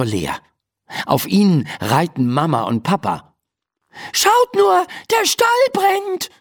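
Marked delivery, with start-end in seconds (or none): 4.1-6.3 high, alarmed call. Acted. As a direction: cry the warning in a high voice. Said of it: "ruft Laura". (hoher, alarmierter Ruf)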